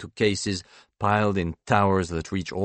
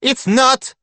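high, loud retort; indignant high-pitched objection; sharp contradiction; 7 dB louder than usual. A narrator's reading. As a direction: cut in to flatly deny it. Mother chimes in contradicting.

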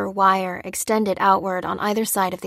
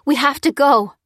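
urgent; 7 semitones higher, 6 dB louder than usual. A narrator's reading. Urgent rush to leave.

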